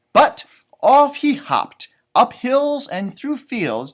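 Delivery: storybook narration. A narrator's reading. Reading aloud to a child, slow and lively. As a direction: draw out the journey for the child.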